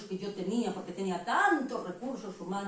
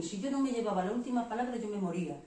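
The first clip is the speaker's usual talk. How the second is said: ordinary talk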